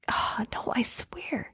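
hushed whisper to herself. Real, not acted. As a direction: whisper it under your breath. Aside while searching the wallet.

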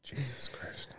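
breathy whispered exclamation. Real, not acted. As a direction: whisper it under your breath. Aside after laughter.

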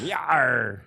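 pirate yar; pirate cheer. Pretend. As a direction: shout it like a pirate. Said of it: "It's fun to be a pirate".

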